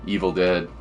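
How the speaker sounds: ordinary talk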